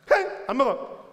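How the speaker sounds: high, loud huff of refusal; hammed-up sulk. King refusing dinner at home, hammed-up sulk. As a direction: huff like a pouting child. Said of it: "얼마나 심하게 삐졌냐면".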